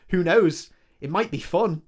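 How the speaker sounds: loud, high fake enthusiasm; forced cheer. A narrator's reading. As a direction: cover the fear with false brightness. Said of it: "she forced a smile".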